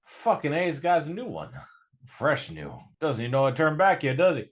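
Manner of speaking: high, loud, fast thug whooping; excited Dylan. Acted. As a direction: crow over the fresh recruit. Dylan excited about new werewolf.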